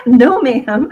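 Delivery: lightly imitating a firm mom saying no; her mom's no ma'am. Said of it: "That's what my mom would say".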